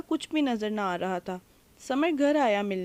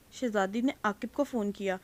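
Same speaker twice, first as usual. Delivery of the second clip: ordinary delivery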